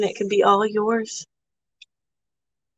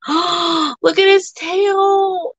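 delighted gasp; 7 semitones higher, much slower than usual. Real, not acted. Gasping over a pet's tail.

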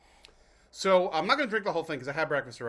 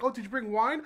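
high, chirpy mock party guest; 10 semitones higher than usual. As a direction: ask it like a delighted host at the door.

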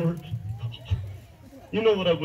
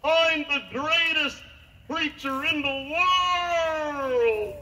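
high, slow holler with long final word; yelled boast; 12 semitones higher, much slower than usual. Acted. Man boasts to the Grand Canyon, drawn-out yell. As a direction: call it out across a canyon, stretching the end. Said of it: "And so he yelled out".

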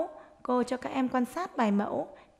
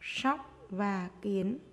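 dictating; slower than usual. Shown as a demonstration. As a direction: dictate word by word to young pupils. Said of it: "hãy nghe cô giáo đọc để chúng mình viết".

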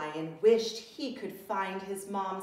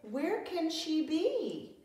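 high, slow, forlorn question; 小狮子难过地慢慢问妈妈在哪; sad little lion; 9 semitones higher, slower than usual. Acted. Lost cub getting sad.

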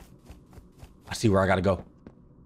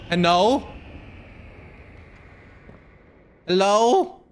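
calling out; 11 semitones higher, much slower than usual. Real, not acted. Calling out in the dark.

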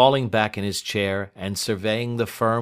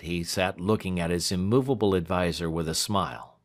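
plain reading voice

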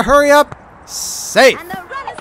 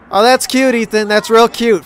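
usual speaking voice

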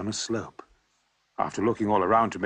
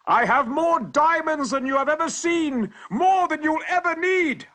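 a big triumphant shout, much higher and louder; 6 dB louder than usual. Acted. Bloodied traveller shouting his boast to the terrified hunters. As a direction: yell it out boastfully, pockets full of diamonds. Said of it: "I shouted".

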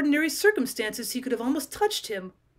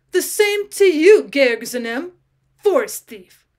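furious curse; 3 semitones higher, 9 dB louder, slower than usual. Acted. Ulrich cursing Georg back, loud and furious. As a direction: fling the curse straight back. Trapped enemies exchange curses.

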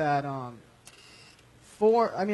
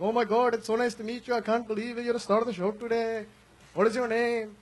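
high, lilting mock Indian accent; fake Indian accent; 4 semitones higher than usual. Crew members gushing to the show's star in a mock Indian accent. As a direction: put on a higher, exaggerated Indian accent. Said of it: "that fake accent part".

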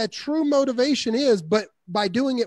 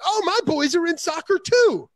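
much higher, eager to share; eager me-too; 8 semitones higher than usual. Retold or imitated. Seller cutting in about his own boys, eager and high. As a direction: jump in to make it about yourself. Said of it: "A lot of people are like".

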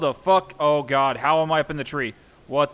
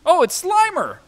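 high, loud delighted cry; light up at the name; excited recognition; 12 semitones higher, 6 dB louder than usual. Real, not acted. Gamer spotting the ghost in the game, excited and higher.

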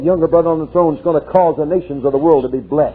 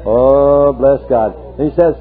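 usual speaking voice

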